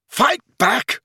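high, furious yell; screamed challenge. A narrator's reading. Harry goading Snape, screamed. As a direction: scream it as a dare. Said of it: "Harry screamed at him".

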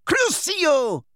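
high-pitched cry of a spell; shrieked curse. Acted. Witch casting at a boy in a duel, shrieked.

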